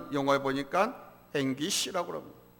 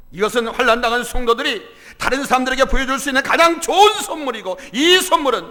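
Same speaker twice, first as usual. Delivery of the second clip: loud, raised, impassioned sermon voice; full-force preaching; 6 semitones higher, 12 dB louder than usual. Acted. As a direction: drive the point home to the congregation. (힘주어 외치는 설교)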